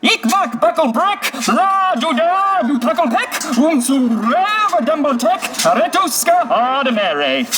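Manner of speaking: high, slow, drawn-out nonsense chant; puppet voice. Acted. Puppet performing its story for watching kids, high puppet voice. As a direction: declaim it as a high-pitched puppet. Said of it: "It comes from a puppet voice".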